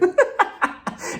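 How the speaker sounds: airy high giggle; breathy high laugh. Real, not acted. Laughing at her joke.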